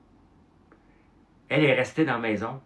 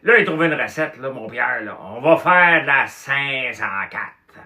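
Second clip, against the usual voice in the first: booming, much higher and louder, slowed on the number; Claude Cloutier's big voice; 6 semitones higher, 10 dB louder than usual. Retold or imitated. Claude Cloutier boasting his plan to Pierre. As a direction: bellow the plan like a drunk plotter. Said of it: "il parlait avec une grosse voix".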